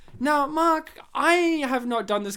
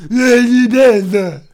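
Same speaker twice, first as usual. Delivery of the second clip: loud, slow Stallone tough guy; Sylvester Stallone impression; 2 semitones higher, 12 dB louder, slower than usual. Host doing his own Sylvester Stallone voice. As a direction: lean into a hammed-up Stallone. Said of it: "my Sylvester is different".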